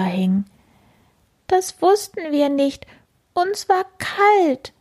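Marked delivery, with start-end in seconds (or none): 1.5-4.7 much higher, slow sorry bug voice; guilty little beetles. Acted. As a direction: plead small and slow. Beetles apologize.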